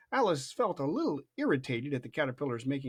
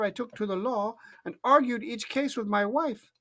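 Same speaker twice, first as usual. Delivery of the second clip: higher, sing-song recital of the poem; Alice's recitation voice; 8 semitones higher than usual. A narrator's reading. Alice reciting the poem to the caterpillar, higher. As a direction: recite it brightly like a schoolgirl. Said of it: "I'll read this in Alice's voice".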